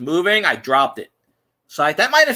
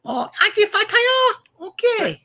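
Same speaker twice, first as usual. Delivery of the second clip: very high, stretched-out goofy caller; prank-call customer voice; disguised voice; 13 semitones higher, slower than usual. Shown as a demonstration. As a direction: stammer slowly through a high put-on voice. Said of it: "I'm trying to drop it down".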